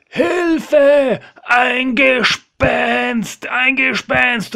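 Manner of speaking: high, drawn-out cry for help; terrified yelling. Acted. A man in the boat spots a ghost, panicked high yell. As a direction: bellow in panic, stretching the words. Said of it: "Und dann brüllt einer los".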